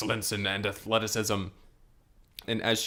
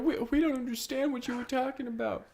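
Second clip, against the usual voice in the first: hammed-up frantic squeal; panicked mock pleading. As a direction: plead like a pelted bystander, ending in a wail. Acted victims pleading with their attacker.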